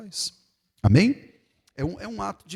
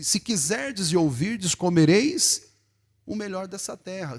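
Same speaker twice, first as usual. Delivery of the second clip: solemn scripture reading; 4 semitones higher, 5 dB louder, slower than usual. Acted. Reading Isaiah.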